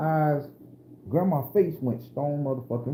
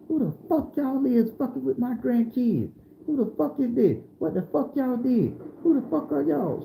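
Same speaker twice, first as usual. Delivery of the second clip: raised high-pitched furious grandmother voice; Grandma yelling at strangers; 9 semitones higher than usual. Retold or imitated. Grandma confronting the men who cornered her grandkids, in a high furious voice. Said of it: "and Grandma like".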